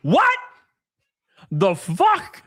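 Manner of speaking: high, shocked "What?"; stunned squeak of surprise. Real, not acted. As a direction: squeak it out in disbelief. Shocked by the reply.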